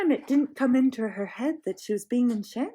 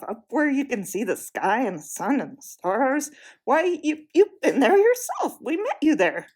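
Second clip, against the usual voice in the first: put-on exasperated boy; 4 dB louder than usual. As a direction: insist hotly, losing patience. Scrub argues angrily.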